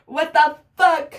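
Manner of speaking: slow, drawn-out what the fuck; slow stunned what the fuck; stunned disbelief. Real, not acted. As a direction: let it hang in disbelief. Stunned reaction continues.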